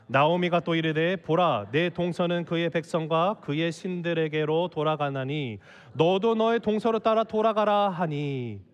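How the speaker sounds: low, measured reading of a verse; scripture reading. A narrator's reading. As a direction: read the Bible passage aloud, solemnly. Reads the Bible verse aloud.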